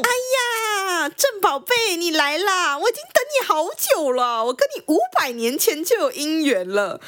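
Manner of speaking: high, gushing coquette; hammed-up lovestruck cooing, pitched high; sweet-talking lover. Woman improvising to win over the intruder, gushing sweet talk. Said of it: "画风突然开始变得海誓山盟".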